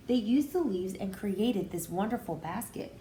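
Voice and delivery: usual speaking voice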